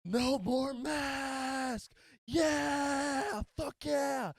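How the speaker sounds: very high, loud, slow yell; wild anti-mask cheer; retold screaming. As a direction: scream it like a rally cry. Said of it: "This guy starts screaming out".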